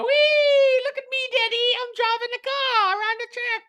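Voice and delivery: put-on very high childish voice, gleeful; mock child driver showing off to daddy; mocking spoiled kid. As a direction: squeal like a spoiled little boy to his daddy.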